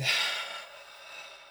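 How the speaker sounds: long airy exhale without voice; breathing-out sigh. Shown as a demonstration. Meditation guide breathing along with listeners, long sigh. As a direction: let all the air out slowly. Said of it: "take the time to breathe".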